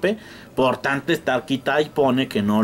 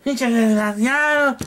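high, slow put-on curse; mock insult; 8 semitones higher than usual. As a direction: spit it out at the cheat. Mock anger at seller. (insulto lento y agudo, fingido)

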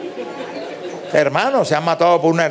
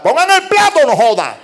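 put-on high, loud kid's yell for his plate; a child loudly demanding food; 10 semitones higher, 4 dB louder than usual. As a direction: bark it out like a hungry kid.

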